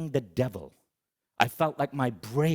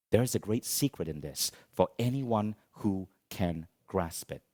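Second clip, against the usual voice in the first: ordinary talk, nothing marked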